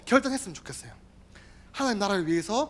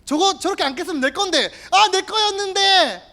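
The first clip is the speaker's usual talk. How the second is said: whining Judas; 9 semitones higher, 5 dB louder than usual. Acted. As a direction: mourn the money as if it were yours. Judas's inner complaint voiced.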